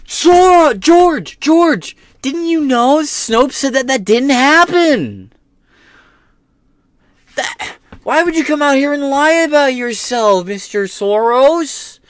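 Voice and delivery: high, loud exasperated yelling. Real, not acted. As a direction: shout it at the screen in disbelief. Yelling at Soros on screen.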